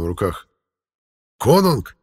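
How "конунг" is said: high, loud joyful cry; excited exclamation. A narrator's reading. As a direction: cry out in glad surprise. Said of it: "воскликнул один из них".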